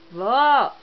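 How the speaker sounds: loud call across the house; calling grandma. Shown as a demonstration. Mother calls grandma for the baby, loud call. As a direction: shout for grandma to show the baby. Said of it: "Chama a vó".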